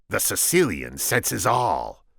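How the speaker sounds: slow, pompous proclamation; slow boastful Sicilian; grandiose Sicilian. Acted. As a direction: announce it slowly and proudly.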